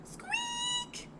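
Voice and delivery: very high, soft mouse squeak. Shown as a demonstration. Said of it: "They sound like this".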